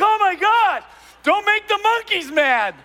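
high, loud and slow mock panic; startled teens. Teens scattering from the rock, high loud mock panic. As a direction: yelp like scattering guys. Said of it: "they're like".

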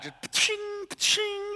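high, soft imitated pa-ching of the bat; bat crack sound. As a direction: mimic the sweet crack of a ball off the bat. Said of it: "making that sweet sound off of the bat".

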